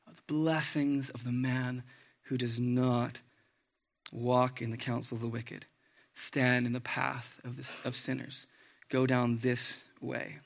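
soft, slow reading of the psalm; quiet devotional reading; scripture reading. Acted. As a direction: read it gently, savoring each phrase. Reading Psalm one aloud.

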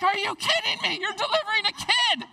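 high, loud, laughing disbelief; incredulous exclamation. Retold or imitated. Husband at the birth, laughing in loud disbelief. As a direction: blurt it out amazed, half laughing. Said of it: "I'm like".

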